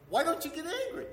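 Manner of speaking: high-pitched imitation of a sweet daughter. Father mimics his never-angry oldest daughter, high sweet voice. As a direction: put on a high, sweet girl's voice. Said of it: "she's just sweet".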